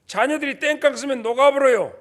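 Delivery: normal talking voice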